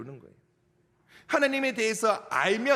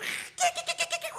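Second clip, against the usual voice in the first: dog yelp; slower than usual. Retold or imitated. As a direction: imitate a frightened dog's yelp. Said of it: "개가 ... 뒹굴면서". (웃으며 흉내 내는 개 낑낑거림)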